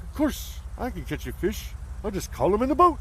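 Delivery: high, smug puppet voice; cocky Muppet. Retold or imitated. Ernie bragging to Bert he'll catch fish, high smug puppet voice. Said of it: "And he's like".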